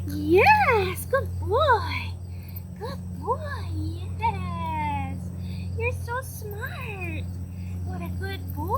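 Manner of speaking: high, sing-song praise for the dog. Real, not acted. Trainer praising the puppy in a cutesy baby voice. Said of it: "in your cutesy baby voice that you have for your pet".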